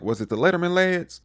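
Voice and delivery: high, loud mocking jab; mock teasing interrogation. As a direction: needle him like a know-it-all big brother. Steve grilling Dustin, mocking.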